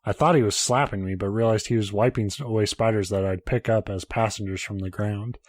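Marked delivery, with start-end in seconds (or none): none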